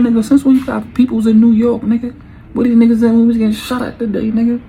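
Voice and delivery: high, loud, fast angry rant; angry yelling. Retold or imitated. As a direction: yell it in his face. Yelling at cousin about shooting.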